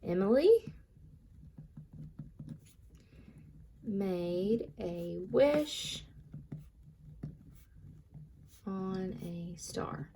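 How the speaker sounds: teacher dictating a sentence very slowly for copying; slow dictation. Shown as a demonstration. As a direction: spell it out slowly so kids can write along. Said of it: "So I'm gonna say".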